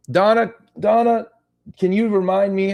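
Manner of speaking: high, loud playful calling out; mock old man. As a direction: jokingly holler for help as an old man. Replays old man bit, then kidding.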